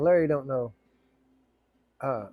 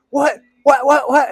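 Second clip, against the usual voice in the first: loud high stunned whats; shocked what; 11 semitones higher, 10 dB louder, slower than usual. Retold or imitated. Joe hears he won, loud stunned shout. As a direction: blurt out in disbelief.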